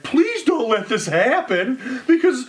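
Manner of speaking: high, hammed-up whimpering plea with a laugh in it; mock tearful plea. Owner's teary letter to the commissioner, mocked as a high whimpering plea. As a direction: whine it like a crybaby begging, half laughing. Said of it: "a little letter that's soaked in tears and alcohol".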